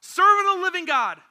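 loud anguished call to Daniel; anguished cry. Acted. King calls to Daniel at the den, loud and anguished. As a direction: cry his name out in anguish. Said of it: "he cried out in an anguished voice".

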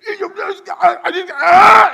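high, shouted sputtering in mock rage; too mad to talk. Caretaker enraged at three-year-old, sputtering mad. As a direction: act out rage choking off the words into a yell. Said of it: "so mad you can't really talk".

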